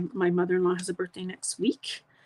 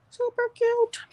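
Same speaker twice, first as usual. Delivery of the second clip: excited squeal. Real, not acted. Admiring finished card.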